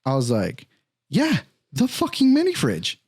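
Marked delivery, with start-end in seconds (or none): 1.1-3.0 exasperated. Retold or imitated. Exasperated answer to detective.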